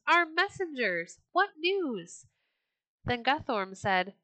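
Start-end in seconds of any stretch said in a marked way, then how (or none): 0.1-2.3 higher, keen call to the returning messengers; lightly voiced king greeting his men; eager greeting. A narrator's reading. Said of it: "cried King Harold".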